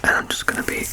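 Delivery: soft breathy whisper, close to the ear; ASMR whisper. Acted. Clinician checking the patient's ears, whispered softly. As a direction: whisper gently like a careful clinician.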